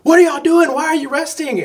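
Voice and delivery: loud, high exasperated yell; retold screaming. Fan yelling at resting athletes on a livestream, retold screaming. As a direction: holler at slacking athletes, pitch way up. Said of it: "I'm screaming at the phone".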